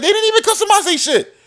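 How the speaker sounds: very high, squeaky disbelief with a laugh; mocking disbelief. Mocking reviewers who never customized their consoles, high and laughing. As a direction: scoff at them in a shrill mock voice. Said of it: "I'm like".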